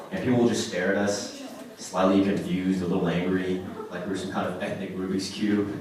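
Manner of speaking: ordinary talk, nothing marked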